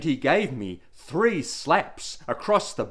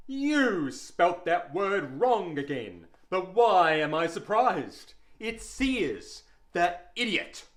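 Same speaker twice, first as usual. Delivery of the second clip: scornful teacher; 6 semitones higher than usual. A narrator's reading. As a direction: drag out the contempt, pitched up. Teacher belittles pupil.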